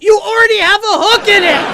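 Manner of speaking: loud, high, laughing yell; exasperated shout. Retold or imitated. Uncle yells back at crying nephew, laughing shout. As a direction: bellow it in disbelief. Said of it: "And I'm like".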